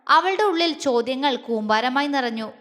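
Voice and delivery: ordinary delivery, nothing marked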